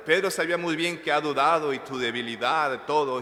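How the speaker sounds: usual speaking voice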